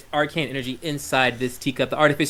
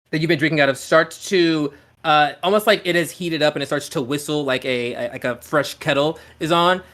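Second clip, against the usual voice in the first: ordinary talk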